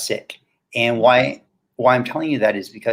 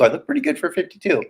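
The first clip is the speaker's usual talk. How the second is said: ordinary talk, nothing marked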